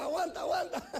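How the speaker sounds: high, loud, laughing through the words; laughing quote. Retold or imitated. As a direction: quote them while cracking up. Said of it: "me dijeron".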